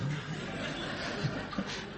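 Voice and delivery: quiet unvoiced chuckle; soft breathy laugh. Real, not acted. Preacher laughs at his own joke, quietly and breathily. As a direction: let a soft laugh slip out.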